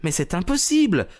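high-pitched voice of protest; protesting young governess. A narrator's reading. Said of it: "protesta mademoiselle Hunter". (voix aiguë de gouvernante qui proteste)

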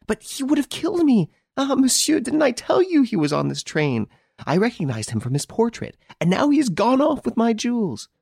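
a robbed lady, still shaken; agitated woman's voice; distressed lady. Acted.

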